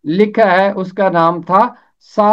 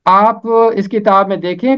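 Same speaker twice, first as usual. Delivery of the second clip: ordinary delivery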